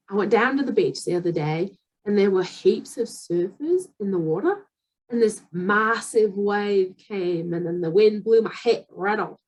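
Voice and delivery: conversational Aussie with rising phrase ends; Australian beach yarn, vowels stretched; Australian accent. Shown as a demonstration. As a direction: tell it in a put-on Australian accent. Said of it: "let me try that again".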